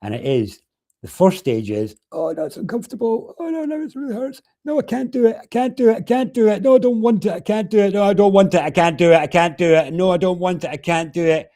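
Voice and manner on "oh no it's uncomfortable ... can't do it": high, loud panicked pleading; a woman in labor protesting, high and loud. Acted. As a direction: beg not to go on, voice climbing high. Acting out first stage of labor.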